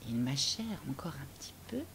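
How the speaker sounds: fast, hushed aside; quick whisper. A narrator's reading. Narrator whispering quickly to children, hushed. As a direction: rattle it off in a whisper.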